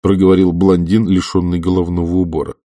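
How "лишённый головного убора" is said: ordinary delivery, nothing marked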